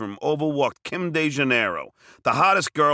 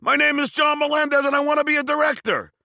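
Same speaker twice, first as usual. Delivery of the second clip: screamed; 12 semitones higher than usual. Retold or imitated. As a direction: push through the stutter by shouting. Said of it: "I stood up and screamed".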